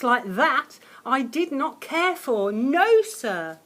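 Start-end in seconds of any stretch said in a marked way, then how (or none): none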